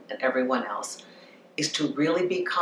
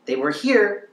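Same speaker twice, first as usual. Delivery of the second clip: "here" pitched up high; high level; 6 semitones higher, 4 dB louder than usual. Shown as a demonstration. Coach showing where their ex was, pitched high. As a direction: say "They were here" high, lifting "here". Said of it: "They'll go down".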